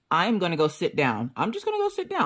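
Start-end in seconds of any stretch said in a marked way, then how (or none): 1.3-2.2 high, soft, tiny voice; small worn-out voice. Pretend. Interrupted all morning, vowing rest, small squeaky voice. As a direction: squeak it softly, as if at wits' end.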